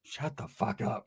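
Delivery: low curt retort. Acted. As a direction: say it slowly under the voice, dropped low. Retold low retort to Ricky.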